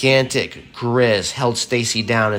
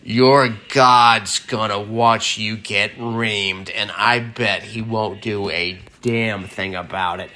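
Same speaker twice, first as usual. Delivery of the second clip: roaring grizzly voice. A narrator's reading. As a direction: growl it out like an angry bear. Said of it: "angry grizzly bear roared".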